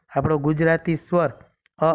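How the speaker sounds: ordinary delivery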